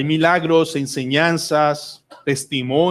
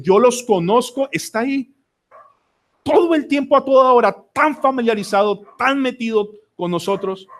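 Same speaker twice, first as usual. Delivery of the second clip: fervent proclamation; 7 semitones higher than usual. Real, not acted. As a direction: preach it out with rising fervor. Preacher proclaims the shepherd. (proclamación fervorosa, más aguda y fuerte)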